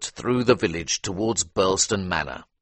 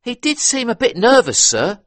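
higher, deferential butler; 7 dB louder than usual. A narrator's reading. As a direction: answer politely as a servant. Butler answers.

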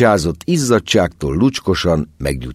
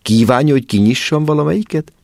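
eager offer; 10 semitones higher, faster than usual. A narrator's reading. Nervous mother offers to open.

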